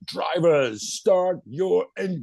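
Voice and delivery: imitating a grand starting command; race starter's call. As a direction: announce it grandly, stretching the words. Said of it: "not an American accent. ... in his Danish accent".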